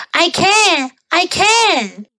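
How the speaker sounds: high-pitched boast; boasting gingerbread man. A narrator's reading. Gingerbread man boasts to the pig, high-pitched. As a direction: insist in a high voice.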